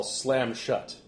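normal talking voice

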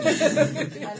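slow giggly gush; laughing. Real, not acted. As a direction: swoon while laughing. Gushing about the actor.

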